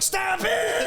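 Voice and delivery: high shrieking yell; mock kid outburst; fed-up shout. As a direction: snap like an angry kid who has had enough. Imitating boy's outburst.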